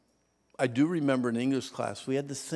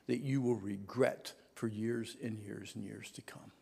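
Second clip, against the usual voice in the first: whispering; 4 semitones lower, 8 dB quieter than usual. Real, not acted. Preacher drops to a whisper.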